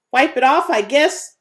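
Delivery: queasy, unsteady answer; shakily. Acted. Ravini, nauseous, answering Colt shakily. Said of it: "he answered shakily".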